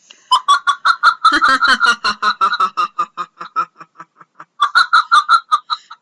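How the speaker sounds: loud rising villain cackle; evil laugh. Pretend. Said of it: "you lead with an evil laugh".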